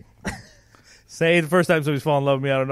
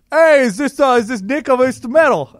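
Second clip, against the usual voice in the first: higher, louder, chummy New York guy voice; imitating the rep's voice; 8 semitones higher, 11 dB louder than usual. Salesman calling a customer, higher louder guy voice. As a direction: play the pushy sales rep, up and loud. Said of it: "You do that voice perfectly".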